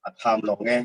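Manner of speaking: measured verse, a pause after every line; poetry recitation. Acted. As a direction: recite line by line, lingering on the phrase endings. Recites second poem.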